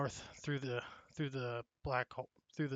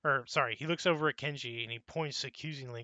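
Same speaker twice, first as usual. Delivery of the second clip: normal talking voice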